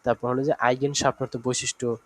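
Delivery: ordinary delivery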